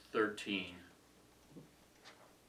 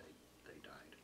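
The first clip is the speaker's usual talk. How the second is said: hushed; 6 semitones lower, 23 dB quieter, slower than usual. Acted. Dramatic end of plague story.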